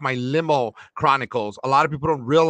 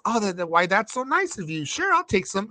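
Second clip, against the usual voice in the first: higher, light mock voice of a grateful celebrity; imitating the actress; 6 semitones higher than usual. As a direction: play her pleased surprise, pitched up. Driver voicing actress's grateful reply.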